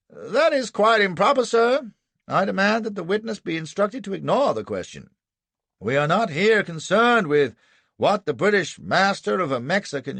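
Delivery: high, loud courtroom objection; indignant defence counsel. A narrator's reading. Anderson objects to the adjudicator, indignant. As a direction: object vehemently, pitch kept well up.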